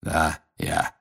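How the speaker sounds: put-on low, gloomy old warrior; grim, low confirmation. A narrator's reading. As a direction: answer darkly and low. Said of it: "мрачно кивнул Грюм".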